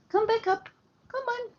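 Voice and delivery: very high coaxing call to the pup; high dog call. Real, not acted. Owner calls the puppy back in a very high coax.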